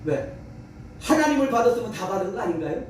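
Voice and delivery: normal talking voice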